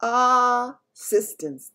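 the giant proudly finding his word; beaming BFG. Acted.

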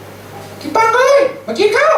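very high, slow long call across the field; sibling calling him to lunch from across the field. Retold or imitated. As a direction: call the boy in from far off to eat. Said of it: "แล้วเขาเรียก".

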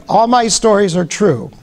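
ordinary talk, nothing marked

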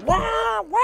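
very high, loud "wah" noises; two loud, high bursts of engine noise; imitated boat roar. Telling friends how he hit the waterfall, high imitated wails.

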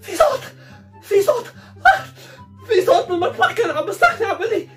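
high, panicky gasped report; frightened. Acted. Telling Obada about a voice in the kitchen, frightened. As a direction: blurt it out in fear.

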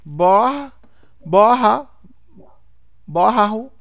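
"borraru" built in loud, separate attempts; laboured sounding-out. Learner fighting with a surname, loud laboured reading. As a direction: push out "borra", pause, then "borraru", very loud. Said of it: "toda vez que você tem dúvida".

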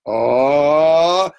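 loud, drawn-out teasing "ooh"; a long rising "oh" before pouncing to tickle; playful loud tickle-attack noise; mock tickle warning. Acts out going for a tickle.

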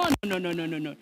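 normal talking voice

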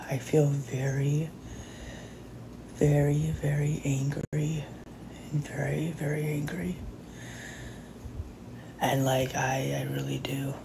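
soft, slowed, fading into a whisper; real suppressed anger. Real, not acted. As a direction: seethe quietly, barely holding back. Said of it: "I'm so mad".

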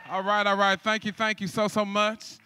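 ordinary talk

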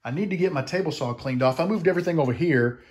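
usual speaking voice

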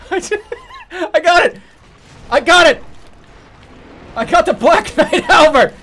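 high, loud and gleeful; triumphant. Real, not acted. Streamer cheering a rare weapon drop, loud and gleeful. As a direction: crow over the prize.

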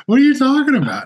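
a startled mother's mock alarm; alarmed mom. Mom hearing her son has a lump, mock alarm.

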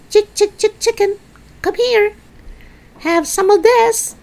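very high sing-out to poultry; coaxing a hen. Acted. George offers the hen the spoon, high coaxing. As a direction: lure the chicken over in a high voice.

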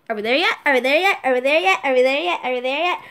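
loud, insistent rising question on repeat; whiny backseat pestering, each one lifting at the end; nagging road-trip passenger. Acted. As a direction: pester the driver relentlessly. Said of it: "like, in a road trip".

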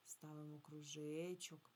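usual speaking voice

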